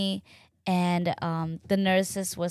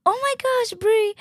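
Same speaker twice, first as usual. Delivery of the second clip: very high retold squeal of recognition; excited surprise; 5 dB louder than usual. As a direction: re-enact spotting your midwife unexpectedly. Retold surprise at seeing her midwife.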